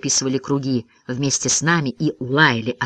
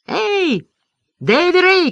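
high-pitched calling; hailing cry; 13 semitones higher, much slower than usual. A narrator's reading. Boy hailing Davy Ray, high calling shout. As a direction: call out to a friend across a clearing. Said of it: "крикнул я".

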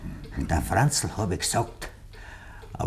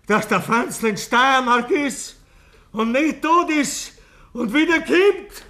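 rising mockery; 12 semitones higher, slower than usual. Killer mocks the story, rising.

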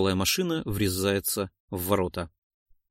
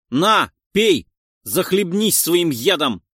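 loud, higher, vengeful yell; furious shout; 6 semitones higher, 7 dB louder, slower than usual. Acted. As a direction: spit it at him in fury. Splashes poison on terrorist.

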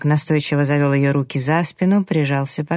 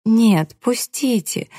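high, pleading protest; plaintive plea; 7 semitones higher, much slower than usual. A narrator's reading. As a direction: plead to be let go, pitched well up. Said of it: "жалобно забилась в его руках девушка".

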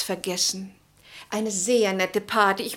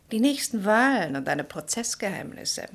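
normal talking voice

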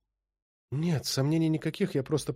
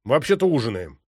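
stern rebuke; 4 dB louder than usual. A narrator's reading. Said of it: "рявкнул я".

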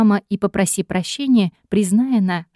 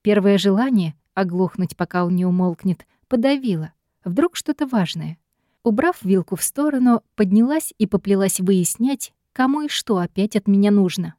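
ordinary delivery

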